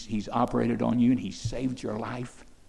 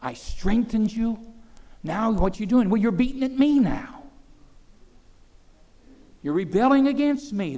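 lightly voiced God, higher and hurt; God's voice; 9 semitones higher, 4 dB louder than usual. God rebuking those he saved, a touch of hurt. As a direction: plead as God to ungrateful people. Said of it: "He said".

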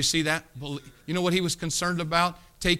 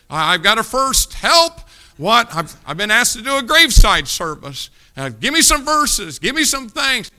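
retelling a panicked call; 5 semitones higher, 8 dB louder than usual. Retold or imitated. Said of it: "called me ... and said".

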